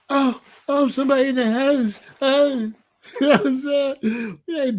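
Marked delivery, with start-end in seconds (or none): 0.1-2.7 high, fretful mock alarm; half-hearted imitation of a panicker. Man mocking someone scared of an intruder, mock panic. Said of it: "You not".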